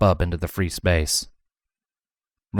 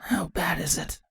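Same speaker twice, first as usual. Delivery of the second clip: soft, weak, high question; barely voiced from a sickbed; asked quietly; 10 semitones higher, 9 dB quieter than usual. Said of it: "Chrysalis asked quietly".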